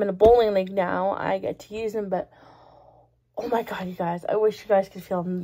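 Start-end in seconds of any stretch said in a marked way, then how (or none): none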